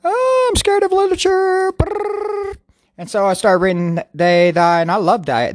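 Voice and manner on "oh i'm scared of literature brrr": very high squeaky scared voice; mock scared squeal. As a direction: squeal it like a frightened kid. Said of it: "I'm like".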